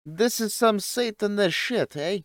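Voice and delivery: high, slow, put-on Italian accent that drifts; shaky attempt at an Italian accent. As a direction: try for an Italian accent and miss. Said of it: "I tried to do the Italian accent".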